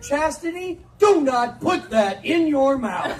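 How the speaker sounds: loud, slow, stern warning; hammed-up parental shout; yelling at child. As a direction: drag out the warning. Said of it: "yelling things like".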